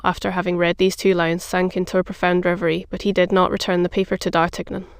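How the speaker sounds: plain reading voice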